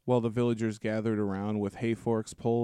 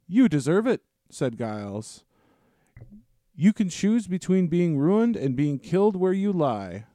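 a stern, raised threat; 6 semitones higher, 3 dB louder than usual. Acted. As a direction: lay down the ultimatum firmly. Farmer threatens the dragon.

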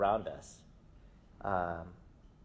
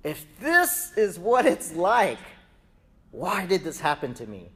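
crying out; 6 semitones higher, 6 dB louder than usual. Retold or imitated. Suffering pregnant Rebekah asks why, crying out. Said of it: "she cries out".

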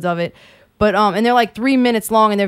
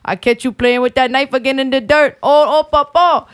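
put-on scolding Cajun papa, Cajun accent. Quoting the show's papa to friends, Cajun accent. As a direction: warn the boy like a Cajun grandpa, Cajun accent. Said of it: "Cajun accent".

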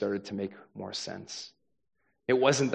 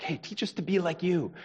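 high, pleading imitation of the followers; eager disciples; 8 semitones higher than usual. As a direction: ask like a keen student. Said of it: "Jesus' disciples are like".

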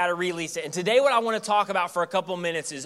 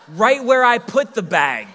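laughing; 3 semitones higher, 8 dB louder, slower than usual. Real, not acted.

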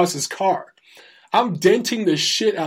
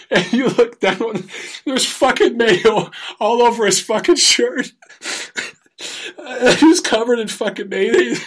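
laughing while telling; 6 semitones higher than usual. Real, not acted.

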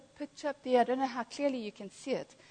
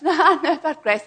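laughing, higher and louder retort; good-natured protest; 6 semitones higher, 10 dB louder than usual. Retold or imitated. Speaker retells friend answering a challenge on grace, good-natured laughing protest. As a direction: brush it off with a loud laugh. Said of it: "He wasn't being ugly".

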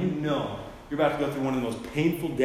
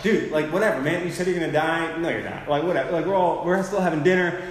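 careless, offhand dude voice, a bit louder; clueless shrug; 6 semitones higher, 8 dB louder than usual. Retold or imitated. Disciples brushing off Jesus, mock clueless and offhand. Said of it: "They're, like, so clueless".

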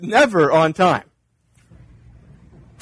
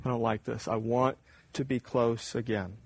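much softer longing prayer; quiet plea; 6 semitones lower, 12 dB quieter than usual. Retold or imitated. Doubt speaking to God, soft and longing. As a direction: say it softly, wanting God near. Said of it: "Doubt says".

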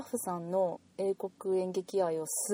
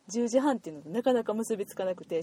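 ordinary talk

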